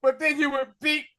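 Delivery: hammed-up pleading son; mock son voice. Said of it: "he was like".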